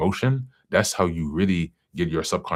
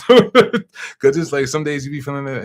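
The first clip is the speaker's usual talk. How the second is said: talking through real laughter, higher; laughing; 9 semitones higher, 9 dB louder than usual. Real, not acted. To her viewers about mood swings, laughing. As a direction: giggle while describing the good days.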